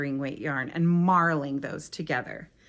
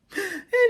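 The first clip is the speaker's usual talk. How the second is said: short laugh; 14 semitones higher, 4 dB louder than usual. Real, not acted. Laughs at own remark.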